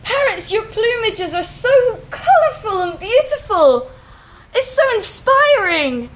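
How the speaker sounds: high, loud, delighted call upward; excited bird calling to the parrots; excited shout. Acted. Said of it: "he shouts up at the parrots".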